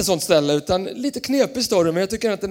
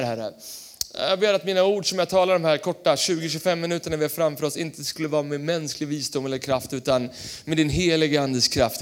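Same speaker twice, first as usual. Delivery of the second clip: prayer; 4 semitones lower than usual. Real, not acted. Opens with a prayer. (lågmäld bön)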